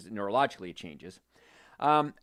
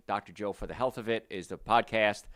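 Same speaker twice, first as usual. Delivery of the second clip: usual speaking voice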